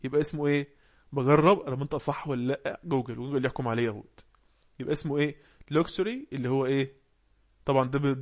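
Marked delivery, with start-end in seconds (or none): none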